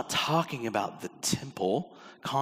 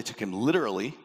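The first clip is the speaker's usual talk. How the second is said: usual speaking voice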